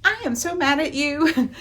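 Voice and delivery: hammed-up cross bride; mock angry heroine. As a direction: bride scolding the prince who lied, mock angry. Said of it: "immediately she's like".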